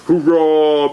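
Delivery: loud booming cheer; booming giant. A narrator's reading. Giant booming a cheer in the dark lair. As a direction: boom it out like a giant. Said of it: "boomed a giant".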